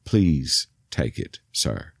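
low, slow plea; quietly insisting. Retold or imitated. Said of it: "he insisted".

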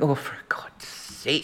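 whispered, slow exasperation; exasperated whisper. Retold or imitated. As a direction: breathe it out under your breath, fed up. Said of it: "And you're going".

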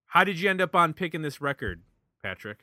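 ordinary delivery

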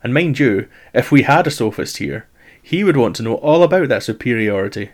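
ordinary delivery

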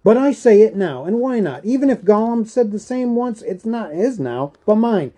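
high, loud, agitated insistence; angry defiance. A narrator's reading. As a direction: burst out possessively, dropping only at the end. Bilbo angrily claims the ring.

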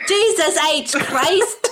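high, drawn-out Irish exasperation; mock-angry Irish. Corrected friend swears at herself, mock-angry Irish accent. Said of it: "When I get mad, I become Irish".

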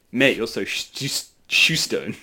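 halting, slow "shoe stone"; "shoe stone" as a slow attempt at a pun; sounded-out picture words. Shown as a demonstration. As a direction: stumble on the "sh", then say "shoe stone". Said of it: "British people insulting your intelligence be like".

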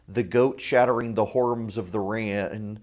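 ordinary talk

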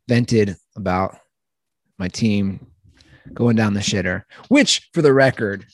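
normal talking voice

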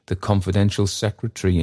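ordinary delivery

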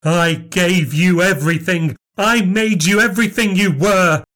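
furious grievance. Acted. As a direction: pour out a lifetime of betrayal. Long furious marital grievance.

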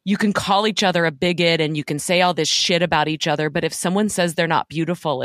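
usual speaking voice

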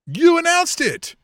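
loud, high, stretched out in disbelief; exasperated outburst. Real, not acted. Co-host ranting at the promoter, exasperated and loud. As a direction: throw your hands up: you announced it.

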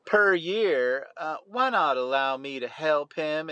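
put-on lightly done Southern Belle accent; a hint of a purring Southern Belle accent. Co-host on request, a light mock Southern Belle accent. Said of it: "in your Southern Belle accent".

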